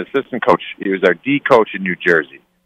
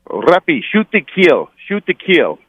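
higher-pitched imitated coach with muddled French-Russian accent; French accent turning Russian; 7 semitones higher, slower than usual. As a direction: try a French accent that slips toward Russian. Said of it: "turn Lappy's French accent into Russian".

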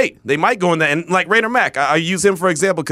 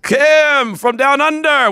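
higher, louder and slow, drawn-out welcome; hyped caller intro; 10 semitones higher, 4 dB louder, much slower than usual. Real, not acted. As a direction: stretch it out like hyping a guest onto the stage. Host welcomes Australian caller.

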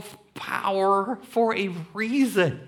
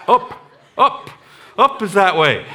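loud exasperated dad; 3 semitones higher, 8 dB louder than usual. Retold or imitated. Dad answering son loudly.

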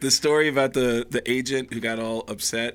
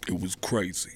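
low, deadpan comedian impression; Tracy Morgan; 7 semitones lower, 7 dB quieter than usual. Eyeballing comedian finally answers about his party, low deadpan. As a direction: drop low and understate it. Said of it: "Tracy rolled up and just eyeballed me".

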